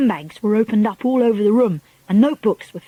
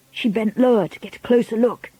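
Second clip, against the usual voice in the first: ordinary delivery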